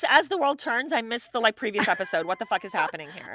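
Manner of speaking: loud mock bewilderment, starting high; confused viewer. Host acts a viewer watching the news, loudly confused. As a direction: demand answers like a lost soap watcher.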